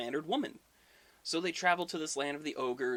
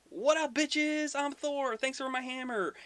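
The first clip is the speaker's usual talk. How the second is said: high, drawn-out gloating holler; triumphant reveal; 12 semitones higher, 3 dB louder than usual. Retold or imitated. Thor drops the disguise once he has his hammer, triumphant holler. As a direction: crow it high over the crowd.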